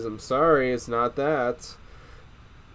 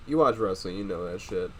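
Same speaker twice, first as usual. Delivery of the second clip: ordinary delivery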